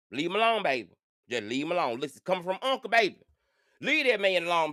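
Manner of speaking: ordinary delivery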